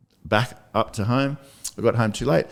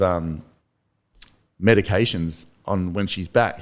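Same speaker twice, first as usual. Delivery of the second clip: ordinary delivery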